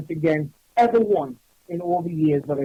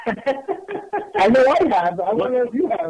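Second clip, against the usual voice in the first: slow, laughing, much higher aside; 6 semitones higher than usual. Real, not acted. Co-host laughs about arguing with the dead, slow laughing aside. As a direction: laugh it out slowly.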